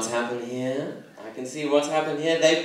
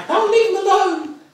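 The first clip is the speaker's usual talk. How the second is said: high, loud mock father laughing through it; a cheery high dad voice brushing it off; father waving it away, mock high voice; father's voice; 8 dB louder than usual. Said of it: "said my father".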